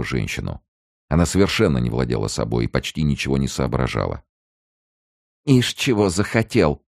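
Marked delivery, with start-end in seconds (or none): 5.5-6.8 high, spiteful hiss; hissed through teeth. A narrator's reading. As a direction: spit it out through clenched teeth. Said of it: "прошипела она сквозь зубы".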